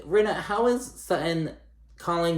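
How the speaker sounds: incredulous outburst. Real, not acted. Arguing with Rinna on screen.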